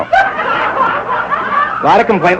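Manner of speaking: very high, very slow mock wail; drawn-out squeal. Shown as a demonstration. Comic repeats complaint to the audience as a squeal. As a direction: stretch it into a shrieking wail.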